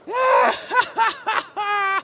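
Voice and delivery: very high, drawn-out cackle; frog's mocking laugh. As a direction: laugh long and high at the soaked mouse. Frog laughs at the wet mouse.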